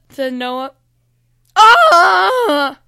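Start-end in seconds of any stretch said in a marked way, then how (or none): 1.5-2.8 loud high "Ah!" scream; gargoyle's yell. A narrator's reading. Gargoyle spotting Noah behind it, loud startled yell. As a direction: yell out in full-voiced alarm. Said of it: "yelled the gargoyle".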